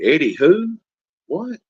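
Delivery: high, playful mock puzzlement; feigned confusion. Host teasing Eddie in chat, feigned confusion. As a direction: pitch it way up, playing clueless.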